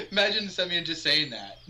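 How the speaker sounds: laughs high, then talks through laughter; real laugh spilling into the line. Real, not acted. Friends reacting, laughing through the line.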